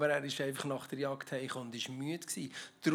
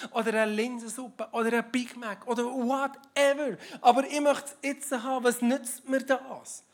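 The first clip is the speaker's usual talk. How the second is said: a lightly raised, pleading Esau voice, impatient for food; hungry Esau; 7 semitones higher, 5 dB louder than usual. Acted. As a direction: voice Esau as a hungry man who wants it now. (leicht gespielter, ungeduldiger hungriger Esau)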